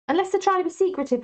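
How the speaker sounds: high, quick teasing aside; playful high tease. Real, not acted. Teasing about sneaky sons.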